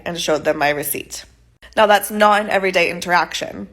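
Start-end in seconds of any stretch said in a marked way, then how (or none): none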